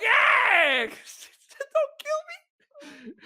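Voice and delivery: high yelp sinking into a squeaky plea; mock terror. Passenger answers armed guard, comically terrified. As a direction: yelp agreement, then squeak the plea slowly.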